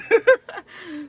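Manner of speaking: high bright laugh falling away. Real, not acted. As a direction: laugh at your own bit. Laughs after retold reaction.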